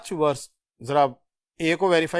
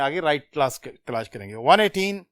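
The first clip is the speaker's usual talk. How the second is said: ordinary delivery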